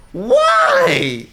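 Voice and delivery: very high, loud disbelieving squeal; squealed why. Real, not acted. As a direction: cry out to the screen. Outraged at sudden ending.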